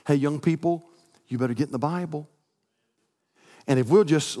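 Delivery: ordinary delivery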